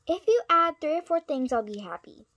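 loud, pushy bargaining; bold haggling. Pretend. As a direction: push hard for a better deal. Haggling in pretend role.

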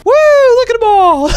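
very high, squealing cartoon voice; squeaky mock voice. Streamer mocking the toys in a squeaky cartoon voice. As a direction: squeak it like a terrified cartoon kid.

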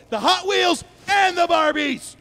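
high, forceful yell; shouted demand. Acted. Robber demands Happy Meal toys, shouting. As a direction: shout out the toy list.

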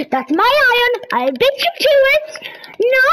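high, loud and fast; fast high outburst; 1 indignant shout. Real, not acted. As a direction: snap it out in protest. Fighting over iron.

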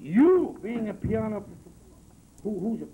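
slow, high, loud outrage; indignant. Real, not acted. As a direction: echo the insult, offended. Indignant echo of insult.

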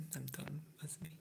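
ordinary talk, nothing marked

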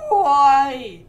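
high, loud exasperated shout; startled loud cry. Real, not acted. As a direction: yell out in frustration. Startled in game.

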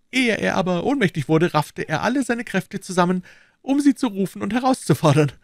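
high, anxious servile voice; bow and scrape with every word; nervous, eager innkeeper; fawning innkeeper. A narrator's reading.

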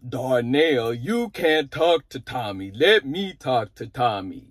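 low, slow, drawn-out father voice; mock fatherly impression, stretching the words. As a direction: impersonate a dad, deep and deliberate. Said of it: "impersonate how his dad was".

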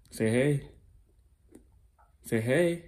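lilting rise on "hey". Real, not acted. Parent prompting a toddler to greet, coaxing. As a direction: prompt a little one with a rising pitch. Coaxing a small child to speak.

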